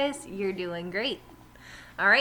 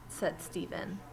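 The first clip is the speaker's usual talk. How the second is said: usual speaking voice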